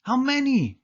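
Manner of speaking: high, eager "how many?"; bursting to know; eager question. A narrator's reading. Roger asks eagerly.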